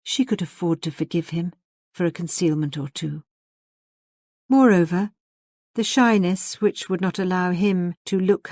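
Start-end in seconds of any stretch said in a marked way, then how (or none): none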